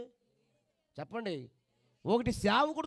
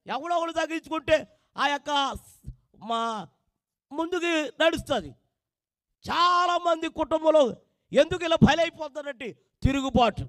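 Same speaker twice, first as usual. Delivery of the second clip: loud, raised preacher's call; full-force preaching; 5 semitones higher, 9 dB louder than usual. Acted. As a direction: drive the sermon home to the congregation. (గట్టిగా ప్రసంగం)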